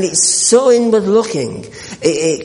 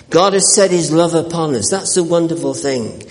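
ordinary talk

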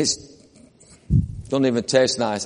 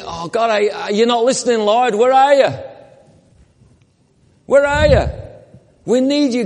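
higher and louder, crying out to God; desperate prayer; 6 semitones higher, 3 dB louder than usual. Retold or imitated. Preacher voicing a believer crying to an absent God, rising despair. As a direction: plead like someone who feels abandoned.

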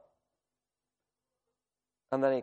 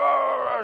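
imitated screaming; 10 semitones higher than usual. Preacher showing the man's shouting, imitated roaring scream. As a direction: mimic a man screaming out wordlessly. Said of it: "screaming and shouting in, in his language".

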